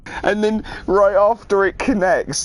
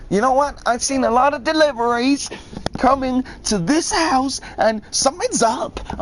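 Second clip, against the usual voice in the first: higher, smug mock voice of a thief; a raised, smug impression of the parcel thief; imitating suspicious woman; 9 semitones higher than usual. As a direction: put on a high, self-righteous neighbour voice. Voicing the parcel-stealing woman.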